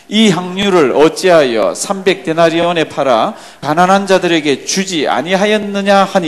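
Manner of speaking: loud, slow, measured Bible recitation; scripture reading aloud. Acted. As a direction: lead the congregation through the verse, strong and drawn out. Leads congregational scripture reading. (크고 느리게 또박또박 성경 봉독)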